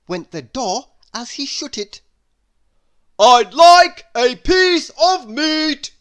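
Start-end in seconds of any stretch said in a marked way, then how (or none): 3.2-5.9 slow, high, very loud boom. Acted. Mister Noisy ordering meat from the butcher, a slow booming bellow. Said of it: "boomed Mister Noisy".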